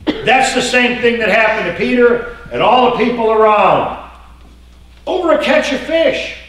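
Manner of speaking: high shout; loud preaching. Real, not acted. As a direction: cry it out high.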